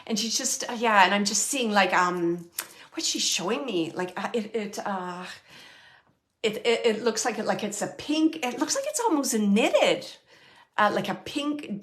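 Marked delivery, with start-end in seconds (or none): none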